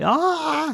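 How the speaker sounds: imitated scream of a man falling; high-pitched mock scream. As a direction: yelp it high, like a scared man dropping. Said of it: "scream as he's falling through".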